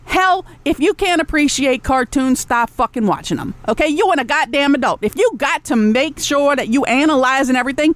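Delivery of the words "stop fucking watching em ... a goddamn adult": ordinary delivery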